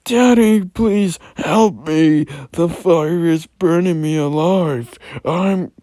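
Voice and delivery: put-on very high, loud pleading child cry, slowed; mock the trapped boy's wail again; smirking boy voice. Arnold continuing the boy's cries with a smirk, high loud wail. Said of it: "With a smirk, he continued".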